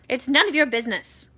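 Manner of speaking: loud, fast and high; curt snap. A narrator's reading. Aunt Spiker telling the boy off, fast and loud. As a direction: bite the words off fast. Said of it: "Aunt Spiker snapped".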